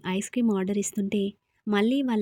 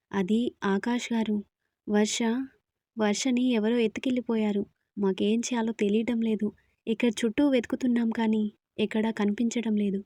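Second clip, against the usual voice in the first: ordinary delivery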